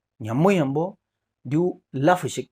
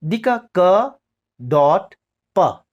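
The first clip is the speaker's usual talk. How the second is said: ordinary talk, nothing marked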